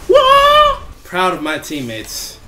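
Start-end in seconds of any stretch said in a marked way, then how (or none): 0.1-0.8 a sudden high, loud whoa; loud surprised whoa. Real, not acted. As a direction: yelp it out in surprise. Big play surprise.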